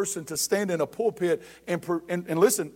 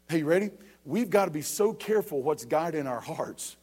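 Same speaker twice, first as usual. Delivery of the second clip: normal talking voice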